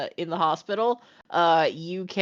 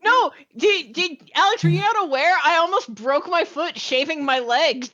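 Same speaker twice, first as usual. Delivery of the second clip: excited; 10 semitones higher than usual. Real, not acted. Excited retelling of mishap.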